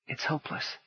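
breathy despairing whisper; hopeless whisper. A narrator's reading. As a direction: whisper it, losing hope. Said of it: "she told herself".

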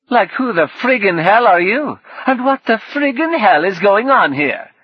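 put-on loud, high, theatrical Northern English accent; brash, hammed-up Northern English outburst; exaggerated Northern English. A narrator's reading. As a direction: demand it in over-the-top Northern English. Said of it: "impossibly exaggerated, theatrical Northern English".

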